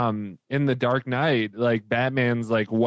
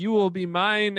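creepy robot voice; 9 semitones higher than usual. Retold or imitated. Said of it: "and he's like".

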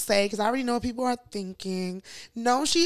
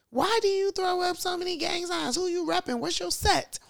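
lightly voiced, higher-pitched questioner; a hint of a prying interviewer, pitched up; radio interviewer; 8 semitones higher than usual. Host retells an interviewer quizzing the rapper, lightly voiced and higher.

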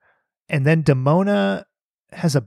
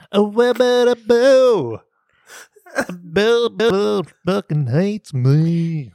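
mock pun pitch; 5 semitones higher, much slower than usual. Joking about band names.

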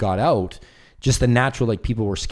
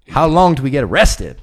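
joking quote; 7 semitones higher, 10 dB louder than usual. Retold or imitated. Quoting Danny's joke.

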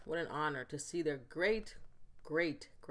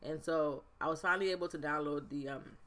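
ordinary delivery

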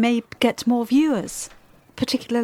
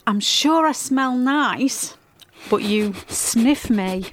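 ordinary talk

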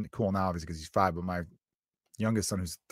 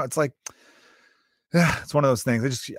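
usual speaking voice

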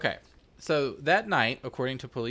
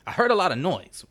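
put-on clipped old-Hollywood accent, a bit louder; mock 1930s film star; transatlantic accent; 6 semitones higher, 6 dB louder than usual. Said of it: "I wonder if I can say this in that fucking transatlantic accent".

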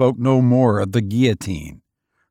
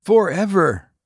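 higher, firm falling promise; firm repeat; 8 semitones higher than usual. A narrator's reading. Wolfgang answers the stranger, firm and sure. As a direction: seal the vow firmly. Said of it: "repeated Wolfgang".

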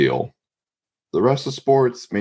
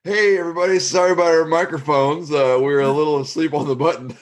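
high mock announcer voice; hammed-up TV host saying sorry; announcer apology; 11 semitones higher than usual. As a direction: play the sheepish broadcaster, pitched up. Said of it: "that's who goes".

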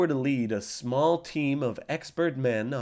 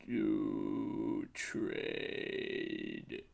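ghostly whisper, much lower and far softer; voice of his gut; 7 semitones lower, 13 dB quieter than usual. Acted. Gut whispering its advice to the spy. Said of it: "it says".

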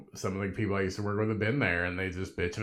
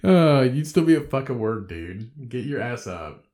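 much louder mock scold; loud self-scolding; 2 semitones higher, 6 dB louder than usual. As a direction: bark it at yourself.

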